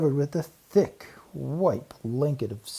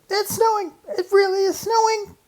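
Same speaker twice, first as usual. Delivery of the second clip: very high little squeak; excited mouse children squeaking; squeaky excited boys; 7 dB louder than usual. Acted. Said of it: "squeaked the two boys".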